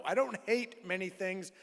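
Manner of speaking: ordinary talk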